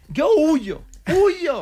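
hammed-up cry of a man fleeing; mock yelling. Dreamer escaping the Inquisition, mock yelling high. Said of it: "y yo gritaba".

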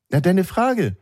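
high, sing-out mock prompt; prodding parent. Parent reminds son of his question, high prodding voice. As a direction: imitate a parent nudging a child in public.

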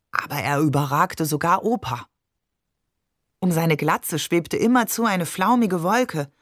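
usual speaking voice

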